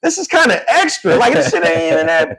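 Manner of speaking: very high-pitched, incredulous complaint; exasperated protest. Retold or imitated. Son protesting dad's punishment to mom, exasperated. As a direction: plead the case in a high, worked-up voice. Acts out protest to his mother.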